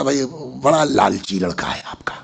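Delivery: hushed whispered jab; teasing whisper to the boy's father. Retold or imitated. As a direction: lean in and whisper it like a joke. Teasing a father about his son.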